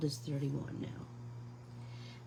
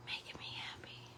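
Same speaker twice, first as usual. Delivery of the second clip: whisper; 14 dB quieter than usual. Real, not acted. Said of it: "happy whisper while painting".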